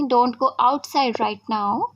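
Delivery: very slow, clear drill of the sentence; slow model sentence. Shown as a demonstration. Teacher to students, slowly modelling the sentence. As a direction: say it word by word for learners. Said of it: "आप किसी को बोलना चाहते हैं".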